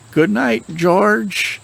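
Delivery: high-pitched called-out goodnight; hollering. Retold or imitated. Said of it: "just hollered".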